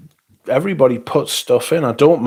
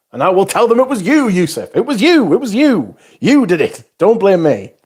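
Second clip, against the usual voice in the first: put-on high, loud childish blame game; mock accusing; 9 semitones higher, 4 dB louder than usual. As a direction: point the finger, hammed-up and squeaky. Mock blaming friend playfully.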